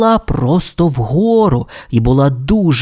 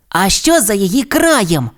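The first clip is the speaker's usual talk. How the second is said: high-pitched inquisitive little voice; curious young dinosaur; 6 semitones higher than usual. Acted. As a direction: ask it like a curious kid. Timmy's character voice, asking.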